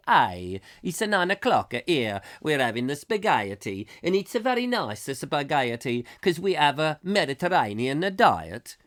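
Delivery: hammed-up Italian accent. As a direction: put on a comic Italian accent. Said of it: "I believe that's how the Italians talk".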